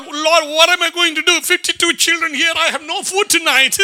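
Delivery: high-pitched, whining plea to God; mock crying out. Orphanage founder begs the Lord for food, mock crying. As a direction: put on a desperate beggar's wail. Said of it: "and then cry out".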